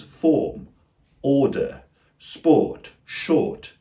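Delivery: FORM, ORDER, SPORT, SHORT with a clear OR vowel; long O vowel. Shown as a demonstration. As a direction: list form, order, sport, short slowly, separated, holding the OR. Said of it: "produced with the O vowel".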